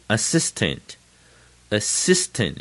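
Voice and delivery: assistant slowed, weight on SIS; stress on SIS. Shown as a demonstration. Teacher drilling new words, slow assistant with stressed SIS. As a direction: drag out assistant with a strong middle SIS. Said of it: "那个C的地方最重".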